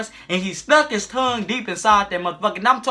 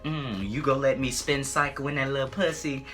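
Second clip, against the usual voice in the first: low, soft moaned-in come-on; lightly acted low, savoring voice; imitated dirty talk; 6 semitones lower, 8 dB quieter than usual. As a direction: hum first, then tease low and quiet. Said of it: "he was talking shit".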